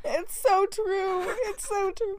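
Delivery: high, slow mock despair; hammed-up wail of defeat; mock lament. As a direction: whine it like a wounded diva. Mock lament about exposed dream.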